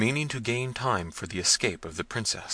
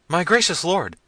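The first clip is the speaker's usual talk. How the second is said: high, loud helpless wail; frightened servants; 10 semitones higher, 7 dB louder than usual. A narrator's reading. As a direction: answer your master high and flustered. Jaquez pleads.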